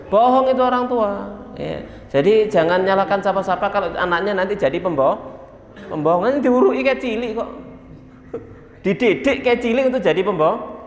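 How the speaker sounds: ordinary talk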